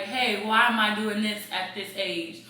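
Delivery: ordinary talk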